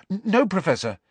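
high, stammered hasty denial. A narrator's reading. Pupil denying detention to professor, hastily. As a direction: deny it hastily, tripping on the first word. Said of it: "said Harry hastily".

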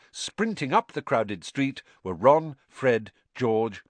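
ordinary delivery

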